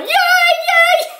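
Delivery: very high-pitched mock cheering; excited kid cheer. Grandson running round the couch, imitated excited cheer. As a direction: imitate a child squealing with delight. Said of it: "running around the couch going".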